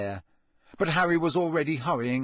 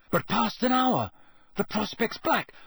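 high, halting recitation; horror-struck recital; 8 semitones higher than usual. A narrator's reading. Harry recalling the clue before the elf, horror-struck. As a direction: recite the rhyme as dread dawns. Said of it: "Harry recited, staring horror-struck".

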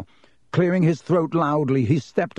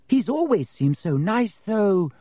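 higher, doubtful schoolgirl voice; uncertain; 8 semitones higher, slower than usual. A narrator's reading. Girl doubting the accusation against Potter, uncertain. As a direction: object hesitantly, pitched up. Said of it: "said Hannah uncertainly".

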